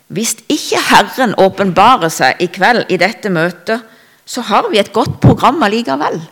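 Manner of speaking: spoken out loud. Retold or imitated. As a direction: read the quote out loudly and emphatically. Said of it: "som er talt høyt ut".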